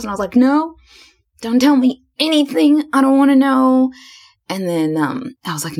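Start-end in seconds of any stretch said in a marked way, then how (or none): 0.3-3.9 high-pitched flustered refusal; mock panicked protest. Telling herself not to guess the proposal, high mock panic. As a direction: act out fending it off, pitched way up.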